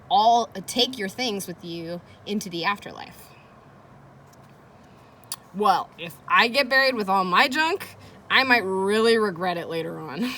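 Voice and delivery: put-on expressive read-aloud for children; reading aloud to kids. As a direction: read it like a teacher hooking the class. Reads diary entry to class.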